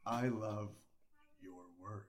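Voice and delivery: low, slow, hushed star voice; Keanu Reeves. Acted. Player voicing an actor for guessers, low Keanu-like voice. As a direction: drop low and trail off softly. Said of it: "it's like Keanu Reeves".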